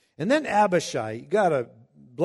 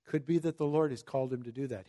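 soft, calm, unbothered reply; a touch of quiet calm; calm acceptance; 4 dB quieter, faster than usual. Retold or imitated. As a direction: let it go, gently. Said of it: "comes back with this just amazing attitude".

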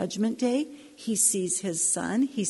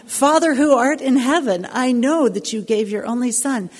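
loud pleading cry to God; 4 semitones higher, 11 dB louder than usual. Retold or imitated. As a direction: call out to heaven at full voice. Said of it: "you should have heard me scream".

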